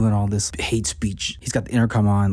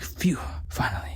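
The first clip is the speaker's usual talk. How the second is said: softer, breathy whispered phew; relieved whisper; 3 semitones higher, 7 dB quieter than usual. Retold or imitated. Sam hearing the treaty is done, whispered relief.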